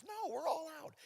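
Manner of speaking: high, soft squeal of alarm; hushed, high-pitched acted fluster; mock panic. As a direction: panic quietly, voice way up. Retold panic over wine.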